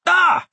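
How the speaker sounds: sharp high yelp of fright; startled scream. Acted. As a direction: cry out in sudden shock. Startled as beast enters.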